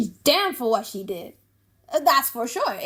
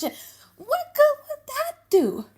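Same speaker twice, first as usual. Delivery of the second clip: higher, slow, drawn-out scornful question; mocking Shylock; much slower than usual. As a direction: stretch it out in scorn. Shylock answering Salarino mockingly.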